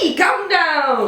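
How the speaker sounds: high, stretched-out retold holler; calling up. Guest answering French model upstairs, slow high call. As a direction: drag out the invitation for someone upstairs.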